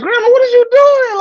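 panic, pitched high; flustered high voice. Retold or imitated. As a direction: grandson blocking grandma, high and loud. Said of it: "I'm just like".

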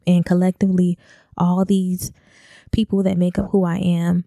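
ordinary talk

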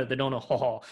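drawn-out Southern drawl through a laugh; Southern movie accent. Acted. Podcaster mocking the actor's line, slow Southern accent. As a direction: stretch the last word in a Southern accent. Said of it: "what's his one line?".

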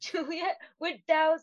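high-pitched mock-poetic wooing; hammed-up olde-English courting; fancy Shakespearean talk. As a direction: put on a lofty stage-lover voice. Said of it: "Fancy talk from Romeo and Juliet".

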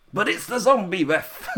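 high, comic dismay; playful imitation of panic; mock alarm. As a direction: gasp it in pretend horror, close to laughing. Mock alarm at zombie referee.

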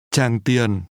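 "Tràng Tiền" with both syllables falling; two low falling tones. Shown as a demonstration. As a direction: let "Tràng" and "Tiền" each sink downward. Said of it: "pronounced with two low falling tones".

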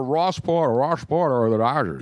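lightly imitated radio broadcaster; a hint of put-on announcer voice; Ross Porter. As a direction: do a sportscaster's slurred signature call. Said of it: "he talked like that".

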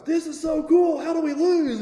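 high-pitched, gleeful amazement; mock giddy confidence. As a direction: imitate a thrilled beginner on a winning streak. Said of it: "he's getting more and more confident".

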